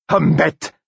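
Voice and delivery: high-pitched, contemptuous outburst; spat insult. A narrator's reading. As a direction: spit it at your enemy in disgust. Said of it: "cracha Justin". (craché avec mépris, d'une voix aiguë)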